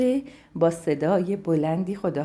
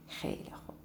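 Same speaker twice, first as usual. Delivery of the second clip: hushed whisper to build suspense; soft secretive whisper; 13 dB quieter, faster than usual. Acted. Storyteller whispers the title.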